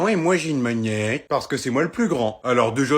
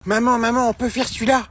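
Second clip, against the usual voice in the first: ordinary talk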